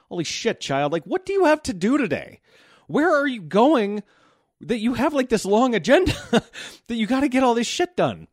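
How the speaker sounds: high-pitched incredulous mock rant; comic parental disbelief, pitched high; exasperated parent. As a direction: demand answers of the child, voice way up, breaking into a laugh. Mock rant at early-rising child.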